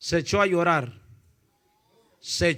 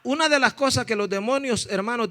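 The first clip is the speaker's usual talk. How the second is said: ordinary talk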